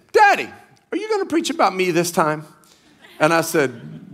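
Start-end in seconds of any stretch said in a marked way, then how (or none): 0.1-2.4 higher, quick voice imitating a daughter; young daughter. Daughter asks preacher dad about his sermon, imitated child voice. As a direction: play a daughter calling on Daddy. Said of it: "And she said".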